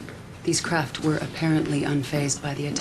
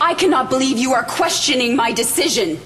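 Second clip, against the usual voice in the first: higher, sharp, offended retort; indignant; 7 semitones higher, 3 dB louder than usual. Acted. Killian snapping back at a soldier who doubts her. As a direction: bristle at being questioned. Leader challenged by a subordinate.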